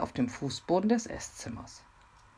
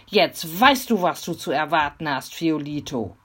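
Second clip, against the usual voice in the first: louder defiant call; shouting; 8 dB louder than usual. A narrator's reading. Mimi warns Fiolito from the window, shouting. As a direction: threaten them loudly from the window. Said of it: "schrie sie".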